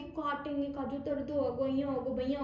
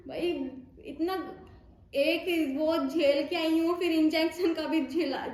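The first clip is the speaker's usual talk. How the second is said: louder, higher exasperated rant; indignant outburst; 4 semitones higher, 4 dB louder than usual. Real, not acted. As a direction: vent the pain, fed up. Venting about painful injection. (झुंझलाकर, तेज़ आवाज़ में शिकायत)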